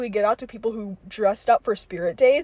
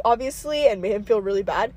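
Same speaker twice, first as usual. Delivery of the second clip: ordinary delivery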